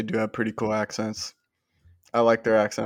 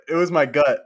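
ordinary delivery

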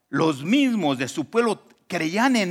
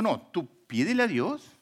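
usual speaking voice

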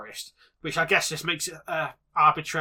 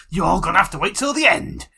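teasing announcement; 8 dB louder than usual. Pretend. Teasing listeners about the next segment.

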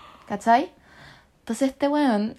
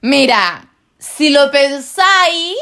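loud, drawn-out insistence; loud protest; 5 semitones higher, 10 dB louder, much slower than usual. Real, not acted. Player defends her silly flamingo guess, loud protest. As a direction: argue it loudly, stretching the words.

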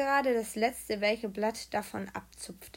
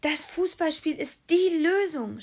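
high, loud, drawn-out revelation; loud, slow, soaring exclamation; excited shout; 8 semitones higher, 7 dB louder than usual. A narrator's reading. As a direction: cry out the sudden realisation. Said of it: "schrie Timo".

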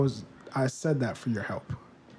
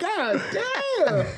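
laughing impression. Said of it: "I'm like".

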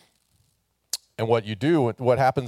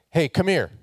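higher, bright call of hey come here; friendly call; 8 semitones higher, 4 dB louder than usual. Retold or imitated. Pastor voicing Jesus calling people over, friendly call. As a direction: call someone over warmly. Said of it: "it's basically like saying".

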